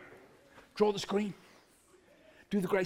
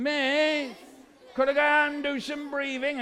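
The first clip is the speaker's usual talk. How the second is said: high, drawn-out schoolchild whine; high-pitched pupil's whinge; whiny kid; 5 semitones higher than usual. Acted. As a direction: plead in a whiny child's voice. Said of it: "always a whiny kid going".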